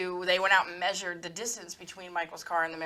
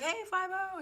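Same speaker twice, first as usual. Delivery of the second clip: dad greeting; 12 semitones higher, 5 dB quieter than usual. Retold or imitated. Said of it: "Dad be like".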